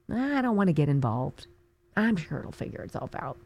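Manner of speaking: mock apathetic voter.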